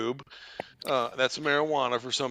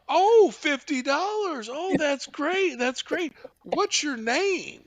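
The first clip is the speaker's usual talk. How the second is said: high, drawn-out imitation of delight; gushing phone volunteer; 12 semitones higher, 4 dB louder, slower than usual. Telethon volunteer thanking a prank caller for a pledge, gushing. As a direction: gush slowly in a raised mock voice.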